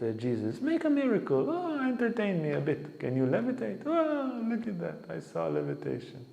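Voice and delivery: high, mock-excited demand for tricks; mocking miracle-seeker. Mimicking people who demand miracles from saints, in a high mocking voice. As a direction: ham up a shallow seeker wanting a show.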